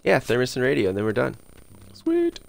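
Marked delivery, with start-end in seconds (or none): 1.9-2.4 high squeak of joy. Real, not acted. Gamer squeaking after planning the last steps, high delighted. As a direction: pitch jumps way up, quick pleased squeak. Quick win.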